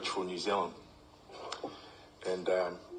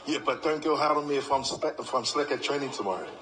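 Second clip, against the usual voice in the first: higher, louder cheeky answer; cheeky reply; 6 semitones higher, 5 dB louder than usual. Retold or imitated. Player answering coach's night-out offer, cheeky higher answer. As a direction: answer cheekily, half joking. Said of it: "And I go".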